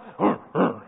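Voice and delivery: low throaty brute grunt; mock ogre noises. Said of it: "these big ogres".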